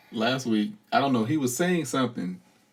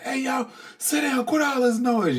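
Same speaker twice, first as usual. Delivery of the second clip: put-on high, hollering at the kids to sit down; yelling at kids. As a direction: yell over the noise, pitched way up. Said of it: "if I go in there and yell".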